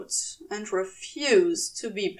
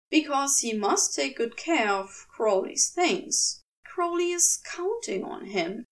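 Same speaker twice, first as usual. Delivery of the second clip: ordinary delivery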